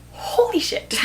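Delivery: breathy whispered exclamation of shock; stunned whisper. Real, not acted. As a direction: whisper it in scandalized amazement. Stunned reaction to the scene.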